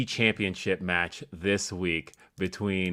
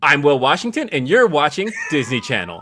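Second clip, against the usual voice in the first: louder, higher mock TV-ident read; Disney Channel promo; 5 semitones higher, 6 dB louder than usual. Co-host auditioning for Disney Channel, mock promo voice. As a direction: announce yourself like a kids' channel star. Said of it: "This is your audition".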